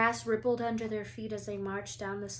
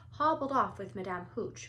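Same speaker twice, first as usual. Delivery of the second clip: ordinary delivery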